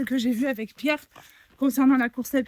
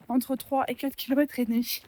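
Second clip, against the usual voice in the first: ordinary talk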